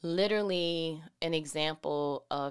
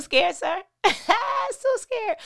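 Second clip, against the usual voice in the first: laughing; 9 dB louder than usual. Real, not acted. Laughing at own joke.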